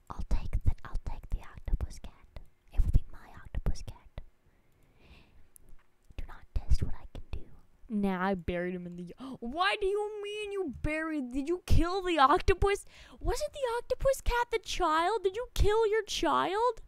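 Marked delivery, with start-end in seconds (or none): 0.1-7.4 fast, unvoiced threatening whisper; menacing whisper. Streamer playfully threatening a friend over a pet, menacing whisper. 9.4-16.8 high, gasping disbelief; shocked questions. Real, not acted. Streamer shocked by friend's confession, high pitched.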